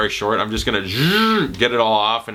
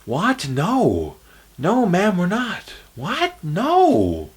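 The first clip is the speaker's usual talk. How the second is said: The Happening quote; 8 semitones higher, much slower than usual. Retold or imitated. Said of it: "The Happening".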